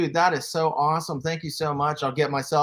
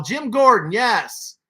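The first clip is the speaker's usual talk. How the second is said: excited recognition; 6 semitones higher, 6 dB louder, slower than usual. Real, not acted. Recognizing a figure from chat.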